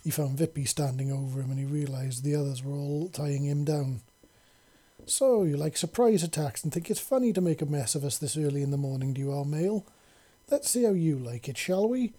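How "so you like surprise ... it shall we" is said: ordinary delivery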